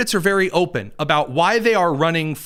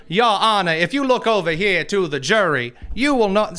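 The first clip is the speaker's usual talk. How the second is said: put-on Southern lawyer accent; 2 semitones higher than usual. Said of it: "kind of Southern gentleman lawyer accent".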